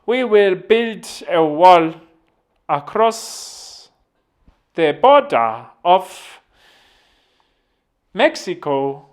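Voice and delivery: Jacob Zuma impression. Imagining Zuma as US president, slow loud speech. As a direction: boom it out slowly like Zuma at a podium. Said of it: "I can only imagine if he was president".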